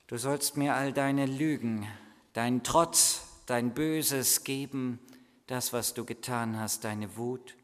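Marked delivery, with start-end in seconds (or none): none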